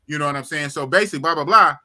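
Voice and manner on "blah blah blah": ordinary talk, nothing marked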